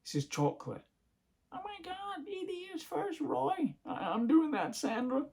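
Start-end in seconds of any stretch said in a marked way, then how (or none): 1.5-3.8 much higher, breathy-soft mock nagging wife. Wife nagging husband about bunny ears, in a high mock voice. 3.9-5.2 higher, put-on husband answering back; lightly voiced, defensive husband; mock meek husband. Husband protesting to his wife, in a higher put-on voice.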